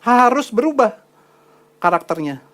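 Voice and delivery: high emphatic call; preaching. Acted. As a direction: press the demand hard. Preaching climax. (khotbah tegas)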